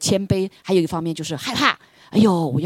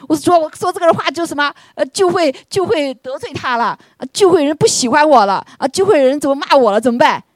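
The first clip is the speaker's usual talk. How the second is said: higher, louder fretting; mock worried self; 9 semitones higher, 8 dB louder than usual. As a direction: act out his own anxious thoughts. (模仿自己焦虑的语气)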